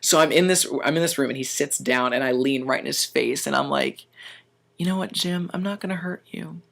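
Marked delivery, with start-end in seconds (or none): 4.8-6.6 quiet threat. Retold or imitated. Waiter leaning into the manager's face, soft and menacing. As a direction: lean in and say it softly.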